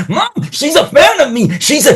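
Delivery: very high, excited call to mom; thrilled disbelief. Retold or imitated. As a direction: squeal it to mom in amazement. Retells calling to his mom, amazed.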